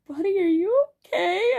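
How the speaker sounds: high-pitched, retold coo of concern. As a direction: coo it high, like checking on a sad buddy. Checks on sad friend.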